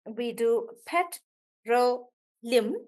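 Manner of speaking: petroleum said slowly with ro stressed. Shown as a demonstration. Teacher slowly models petroleum for the class. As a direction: stretch petroleum and lean on ro. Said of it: "the stress is on ro".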